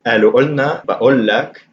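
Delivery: قالوا قلنا, بقول لك with a glottal stop for each qaf; qaf as hamza. Shown as a demonstration. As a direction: drop the q in قالوا قلنا, بقول لك to a catch in the throat. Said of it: "I pronounce qaf as a hamza".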